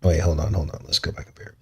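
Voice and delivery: ordinary talk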